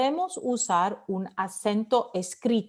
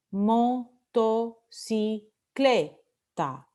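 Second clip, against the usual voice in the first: "Motocicleta" slowly split into mo, to, ci, CLE, ta; syllable by syllable; much slower than usual. Shown as a demonstration. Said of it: "penúltima sílaba".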